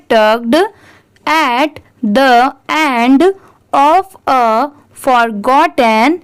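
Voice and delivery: very slow, each word spaced out; teacher reading a line very slowly for students to repeat; slow read-aloud. Shown as a demonstration. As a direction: read it out slowly so they can repeat after you. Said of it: "मेरे बाद आप वो ज़रूर repeat करिए".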